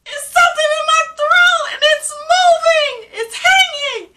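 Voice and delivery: very high, loud wail of alarm; yelling. Retold or imitated. Patient coming off anesthesia feels something in her throat, yelling. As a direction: cry it out at the top of your voice, re-enacting panic. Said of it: "just start yelling".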